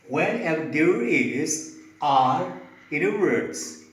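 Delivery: usual speaking voice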